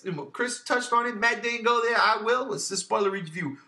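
normal talking voice

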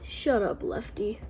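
whispered, hushed rebuke; whispered shush. Acted. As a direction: hiss it quietly aside. Whispered aside to friend.

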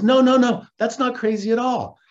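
high, loud protest easing in volume; mock hasty reassurance. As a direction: imitate someone rushing to placate. Retold hurried reassurance.

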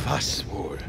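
unvoiced, sly whisper; a low conspiratorial whisper. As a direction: hush it, knowingly. Jack answers, voice dropped.

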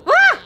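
sudden high scream of fright; startled scream. Real, not acted. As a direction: shriek in surprise. Startled by attacking goat.

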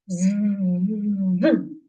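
steady z hum with rising end; buzzing zzz. Shown as a demonstration. Class buzzing with teacher: motor z. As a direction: buzz together like a motor. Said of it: "Всі разом".

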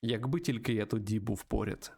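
usual speaking voice

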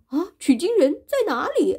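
high, loud and urgent; eager question. Acted. Monster eagerly asking Wukong for the pilgrim. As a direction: ask in a rush to meet him. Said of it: "这个妖怪就说".

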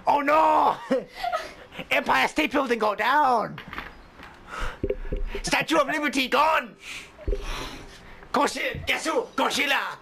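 mock doom in a Japanese accent; hammed-up Japanese monster-movie warning; horrible Japanese accent. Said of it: "doing a horrible Japanese accent".

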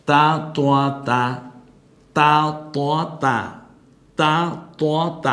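তা light and তোয়া heavy, set against each other; contrast drill. Shown as a demonstration. Teacher contrasting similar letters so students hear the difference. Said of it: "এই তাটাকে তোয়া বলেন, তাহলেও ভুল".

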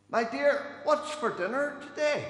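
high, loud call down the hall. Acted. Doctor showing a husband how to test his wife's hearing, calling out. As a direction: shout to someone in another room. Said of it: "and shout down".

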